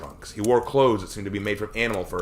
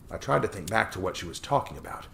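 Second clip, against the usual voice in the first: plain reading voice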